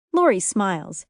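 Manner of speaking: ordinary delivery, nothing marked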